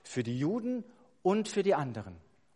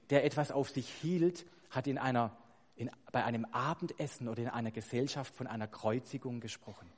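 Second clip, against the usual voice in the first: ordinary talk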